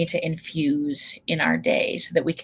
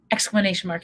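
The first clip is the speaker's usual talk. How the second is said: ordinary talk, nothing marked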